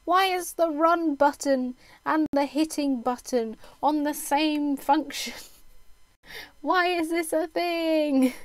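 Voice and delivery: rising, slowing, laughing disbelief at the controls; exasperated complaint. Retold or imitated. Streamer griping to chat about game controls, exasperated. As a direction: moan about the buttons with a laugh breaking in. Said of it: "but I was like".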